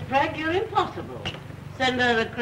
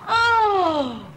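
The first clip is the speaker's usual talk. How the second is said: loud, high, drawn-out wail of dismay; horrified wail; 10 semitones higher, 5 dB louder than usual. Acted. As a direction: cry out as if the world just ended. Mother reacts to daughter's engagement.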